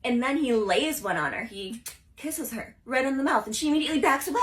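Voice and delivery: ordinary talk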